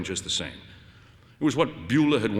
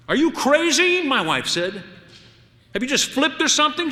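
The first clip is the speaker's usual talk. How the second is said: much higher and loud, incredulous; outraged shriek; 6 dB louder than usual. Acted. Wife appalled by her husband's question, shrieking. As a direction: shriek it in disbelief.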